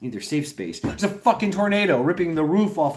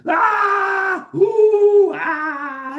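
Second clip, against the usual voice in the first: hammed-up high shrieking screams, much louder; mock screams; 8 dB louder, much slower than usual. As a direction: scream in fake terror to troll everyone. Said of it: "me and my friend were just like".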